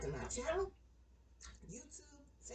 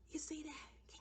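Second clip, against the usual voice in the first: unvoiced whisper; 7 semitones higher, 11 dB quieter, slower than usual. Shown as a demonstration. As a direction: breathe it out softly.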